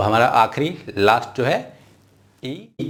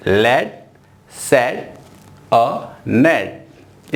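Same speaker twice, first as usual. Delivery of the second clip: "लेट सेट अ नेट" drawn out slowly; correct slow reading; much slower than usual. Shown as a demonstration. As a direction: model the right reading. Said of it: "इस तरह से आपको pronounce करना है।". (सही तरीका, धीरे "लेट सेट अ नेट")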